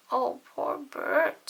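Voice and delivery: high, slow, wobbly furry monster voice; drawn-out sympathy from a fuzzy monster; Grover voice. Acted.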